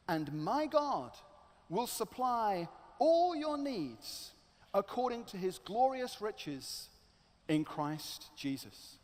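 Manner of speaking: slow, measured scripture recital; reciting a verse. Acted. Sunday school child's memorized verse, recited slowly. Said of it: "made to memorize this verse".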